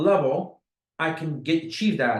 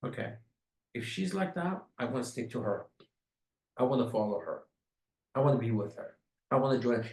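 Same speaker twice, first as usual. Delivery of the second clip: softer, hushed inner voice; prospect's inner thoughts; 6 semitones lower, 8 dB quieter than usual. Retold or imitated. Prospect quietly thinking about following her, hushed. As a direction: let it drop to a quiet private musing. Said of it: "You know what they're thinking?".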